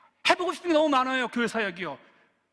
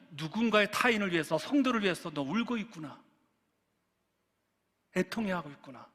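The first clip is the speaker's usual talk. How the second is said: soft, lowered voice fading to near-hush; tender affirmation; 4 semitones lower, 7 dB quieter than usual. Acted. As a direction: speak gently as God comforting a child, with pauses. God's tender words closing the sermon. (낮고 부드럽게 다독이는 말투)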